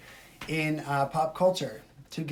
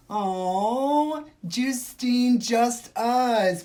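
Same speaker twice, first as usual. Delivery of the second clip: higher, louder, sing-song snooty voice; mock gasp; 8 semitones higher, 6 dB louder, much slower than usual. Streamer mocking a rude commenter's name, snooty gasp.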